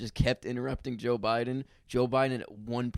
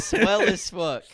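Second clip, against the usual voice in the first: mock cheer; 7 semitones higher, 8 dB louder, slower than usual. Mocking slogan, laughing.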